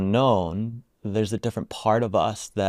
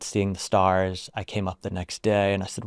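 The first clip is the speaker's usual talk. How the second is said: ordinary delivery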